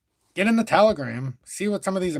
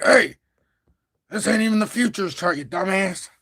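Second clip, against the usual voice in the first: a loud mock heckler, higher; imitated heckler; 5 semitones higher, 3 dB louder than usual. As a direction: mimic a rude viewer calling him out. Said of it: "someone's gonna be like".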